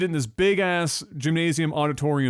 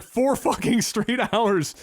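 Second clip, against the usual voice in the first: ordinary talk, nothing marked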